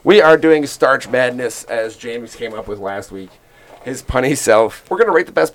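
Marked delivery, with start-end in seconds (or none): none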